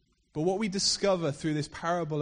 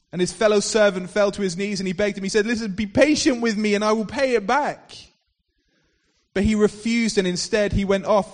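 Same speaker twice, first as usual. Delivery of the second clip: louder, driving parable retelling; preacher telling the parable to his church, building louder; preaching storyteller; 6 dB louder than usual. Acted. Fiery sermon storytelling.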